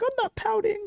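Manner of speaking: very high, whiny imitation; mock pouty denial. Host mimicking friend who denies sulking, mock pout. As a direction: imitate a sulker denying it. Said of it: "why are you pouting".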